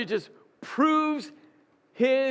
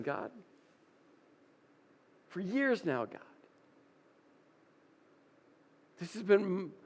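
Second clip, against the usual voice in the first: sinking from plea to spent murmur, softer; Elijah fading out; 3 semitones lower, 7 dB quieter than usual. Acted. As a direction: let the protest die away, drained. Elijah's protest sinks into exhaustion.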